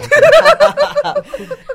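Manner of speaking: high, loud burst of laughter; bright laugh burst; real laughter. Real, not acted. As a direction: laugh out loud at the sweet moment. Host laughing.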